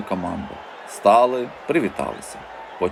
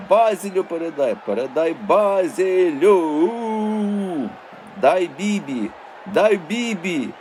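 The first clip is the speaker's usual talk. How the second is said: fan shouting; 7 semitones higher, slower than usual. Acted. Fans shouting at players.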